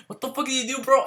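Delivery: high, softened mock outrage; mock angry boyfriend. Boyfriend confronting him over a slap, mock anger. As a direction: imitate an indignant boyfriend without shouting.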